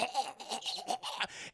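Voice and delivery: soft imitated strangled gagging; choking gasps. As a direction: mimic a man still being throttled. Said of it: "begins choking the shit out of him".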